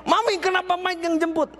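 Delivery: very high-pitched acted alarm; shocked. Startled daughter phoning her mother about who picked her up, shocked. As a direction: exclaim it in startled disbelief, way up high. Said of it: "Mira kaget, saudara. Langsung telepon maminya".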